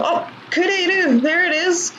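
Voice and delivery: 'Oh, kureru!' like catching it in the act; triumphant find. Real, not acted. Finds the verb she predicted.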